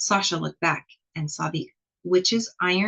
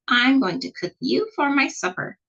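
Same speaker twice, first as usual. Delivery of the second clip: higher, gleeful witchy threat; gloating witch; 7 semitones higher, 3 dB louder than usual. Acted. The witch tells Sasha she will cook her, gloating. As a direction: relish the threat.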